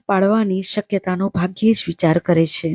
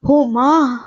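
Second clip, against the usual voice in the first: mild cry for mother, pitched high; child's cry out; 6 semitones higher, much slower than usual. A narrator's reading. Feverish child calls for mother at night, crying out. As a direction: call out higher, like a sick child. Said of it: "બાળકે ચીસ પાડી".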